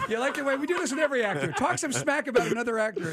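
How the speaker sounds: teasing while laughing. Real, not acted. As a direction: chuckle through it.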